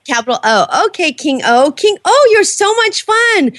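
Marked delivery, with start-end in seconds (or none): 1.7-3.5 higher, slower pet talk. Real, not acted. Host praises the parrot, slow high pet-talk voice. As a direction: coo at the bird like a fond owner.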